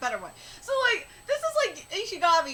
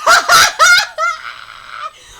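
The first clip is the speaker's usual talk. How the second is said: high, booming belly laugh; loud laughing; 11 semitones higher, 14 dB louder than usual. Real, not acted. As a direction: burst out laughing. Bursts out laughing at story.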